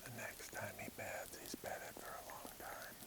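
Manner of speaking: hushed whisper so the deer won't hear; breathy unvoiced whisper while stalking; wildlife watching whisper. Real, not acted.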